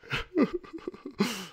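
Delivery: high-pitched laugh after a mock wail. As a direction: break into high giggling. After mocking candidates' fear tactics.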